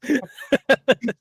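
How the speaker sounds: high cackling hyena laugh; muffled hyena laugh. Acted. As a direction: cackle like a hyena behind a door. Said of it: "was trying to do a muffled hyena laugh".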